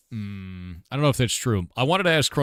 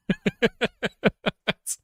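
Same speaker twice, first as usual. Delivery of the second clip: high laugh; 11 semitones higher than usual. Real, not acted. Laughing at getting got.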